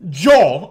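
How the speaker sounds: loud JAW with a rounded "aw" vowel; mock a New Yorker's big "aw" in JAW; jaw with an AW sound. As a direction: JAW said New York style, like "jwaw". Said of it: "that shit had an A O in it".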